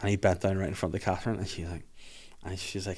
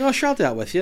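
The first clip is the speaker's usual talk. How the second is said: mock wife; 10 semitones higher, 6 dB louder, faster than usual. Mimicking wife's eager reply.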